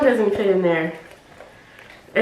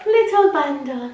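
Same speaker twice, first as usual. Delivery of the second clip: silly voice; 3 semitones higher than usual. Pretend. Vlogger presenting her little bundle to viewers, silly voice. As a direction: put on a silly little voice, savoring the words. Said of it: "I don't know why I'm doing that voice".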